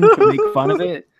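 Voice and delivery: laughing. Real, not acted. Laughing while explaining stops.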